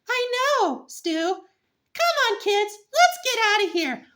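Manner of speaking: very high cartoon voice, disapproving; a gossipy onlooker leaving; squeaky bystander. Bystander character voice.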